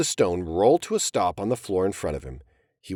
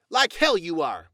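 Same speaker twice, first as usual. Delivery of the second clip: yelled; 7 semitones higher than usual. Acted. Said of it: "He yelled".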